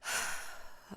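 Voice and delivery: resigned breathy sigh; older sister's acted sigh. A narrator's reading. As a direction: let out an audible sigh before giving in. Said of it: "her sister sighs and says".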